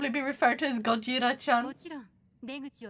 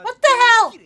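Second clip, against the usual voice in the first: shocked exclamation; 8 semitones higher, 10 dB louder than usual. Real, not acted. Sudden outburst at height news.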